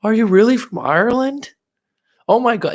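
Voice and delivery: high, loud, excited squeal of amazement; starstruck partygoers. Retold or imitated. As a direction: gush like an amazed party crowd. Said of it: "people are just like".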